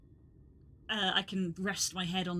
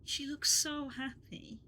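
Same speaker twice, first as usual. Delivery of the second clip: tearful; 5 semitones higher, slower than usual. Real, not acted. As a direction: hold back tears, gently. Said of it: "making me tear up".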